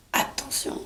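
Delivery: whispered, breath only, no voice; soft whispered "watch out"; hushed warning. Acted. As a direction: whisper it as a sudden aside. Hushed warning when he is present.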